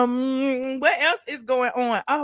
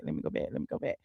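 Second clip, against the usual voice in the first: whisper; 14 dB quieter, much faster than usual. Real, not acted. Repeats under breath while scrolling.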